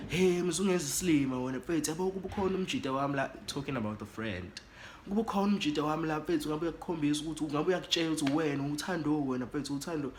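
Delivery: imitating a furious man pressing hard; angry confrontation.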